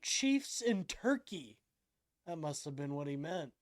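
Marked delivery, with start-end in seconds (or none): none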